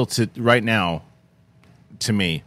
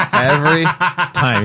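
laughing out loud, drawn out; amused, slow and laughing; 6 semitones higher, 6 dB louder, much slower than usual. Real, not acted. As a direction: say it through real laughter.

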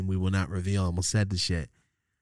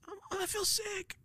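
very high, soft, slow fake-sick whine; 8 dB quieter, slower than usual. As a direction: whimper it like a kid faking sickness. Faking illness to skip school.

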